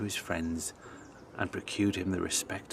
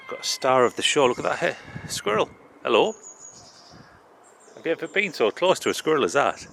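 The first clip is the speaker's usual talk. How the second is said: excited; 5 semitones higher, 7 dB louder than usual. Real, not acted. Meets a tame squirrel.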